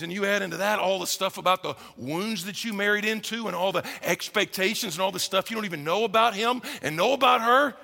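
ordinary talk

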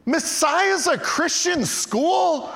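high, slow, incredulous squeal; a stunned girl asking in disbelief; amazed disbelief. Retold or imitated. Volleyball girl amazed about Messiah.

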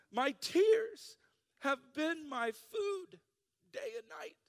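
very high, drawn-out mock whine; whiny. Pastor reading Psalm forty-two as complaint, whiny. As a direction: whine it high, dragging the words out. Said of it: "read this with a whiny voice".

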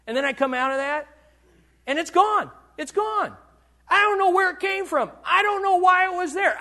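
preacher exulting that it's gone; joyful relief. Acted. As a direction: let the relief ring out. Relief after prayer, anger gone.

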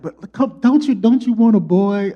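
high, coaxing wife voice; wife coaxing husband for a boy; pleading wife. Retold or imitated. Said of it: "She's like".

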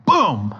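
loud mock pounding boom; punchy playful boom; mallet boom. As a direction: slam the word like a meat mallet. Pounding meat with tenderizer.

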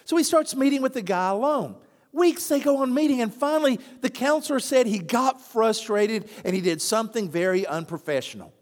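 normal talking voice

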